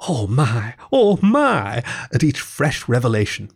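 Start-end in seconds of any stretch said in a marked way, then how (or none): 0.1-1.8 gasping wonder. A narrator's reading. Mole unpacking each new picnic treat, gasping in delight. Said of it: "still gasping".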